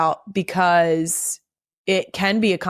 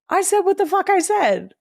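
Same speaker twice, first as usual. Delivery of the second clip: exasperated outburst; 11 semitones higher than usual. Real, not acted. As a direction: blurt it out in disbelief. Mock outrage at headline.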